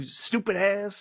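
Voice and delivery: lightly voiced movie character, slow; mock character voice. Impersonating Downey as Simple Jack.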